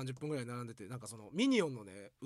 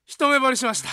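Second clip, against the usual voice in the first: love confession bellowed even louder; 11 semitones higher, 18 dB louder than usual. Retold or imitated. Junior yelling his crush again, even louder. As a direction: belt it out at full volume. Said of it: "でっかいな、声。".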